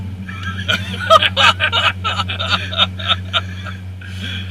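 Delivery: high, breathy laugh of shock; breathless laugh. Real, not acted. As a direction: laugh off the fright. Laughing after the car launch.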